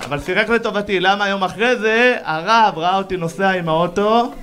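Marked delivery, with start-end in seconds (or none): none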